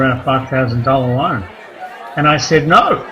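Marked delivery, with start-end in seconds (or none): 2.7-3.0 flat refusal. Retold or imitated. Refusing friend's loan.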